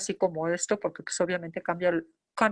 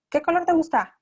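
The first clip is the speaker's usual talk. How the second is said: put-on high, louder question to a child; eager questioning; 8 semitones higher, 6 dB louder than usual. Shown as a demonstration. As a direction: ask it brightly up high, expecting an instant answer. Rapid question to a child, demonstrated.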